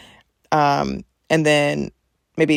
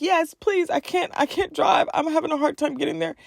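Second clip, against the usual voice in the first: high, teary pleading to the therapist; retold tearful plea; 12 semitones higher than usual. Asking the therapist to come closer, tearful and high. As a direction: beg through tears, voice pitched up. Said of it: "I'm bawling my eyes out".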